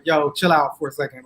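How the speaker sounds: deep, laid-back computer voice; voicing the computer. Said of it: "My computer just said".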